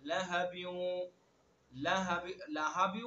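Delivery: very slow, drawn-out chanted recitation; tajweed chant; Quranic recitation. Acted. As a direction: recite solemnly, stretching every vowel. Recites the verse.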